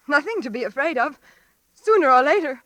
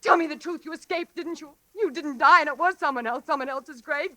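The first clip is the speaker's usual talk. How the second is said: ordinary talk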